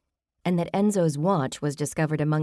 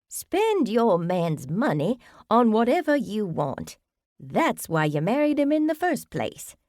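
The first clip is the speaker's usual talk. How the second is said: high whiny voice; 6 semitones higher than usual. Acted. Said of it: "in a high, whiny voice".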